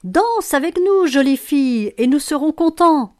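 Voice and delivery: high, loud cries of invitation; joyful call. A narrator's reading. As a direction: call out brightly to draw her into the dance. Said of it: "en criant". (cri aigu et enjoué)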